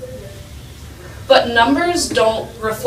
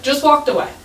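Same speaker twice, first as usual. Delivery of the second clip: ordinary delivery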